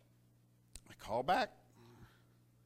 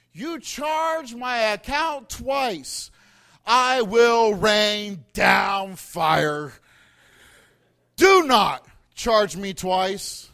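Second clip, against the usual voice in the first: very slow, much louder warning; slow menacing threat; 3 semitones higher, 10 dB louder, much slower than usual. Retold or imitated. Caller warning the clerk not to charge twice, slow and menacing. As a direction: lay down the threat word by word. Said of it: "I so politely said".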